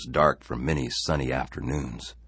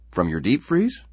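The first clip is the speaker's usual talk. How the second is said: needling question; 8 semitones higher, 7 dB louder than usual. Acted. Man needles Harry further.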